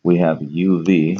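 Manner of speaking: ordinary delivery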